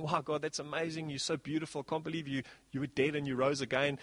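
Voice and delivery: soft, hushed worship in mock awe. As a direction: breathe it like a stunned disciple praying.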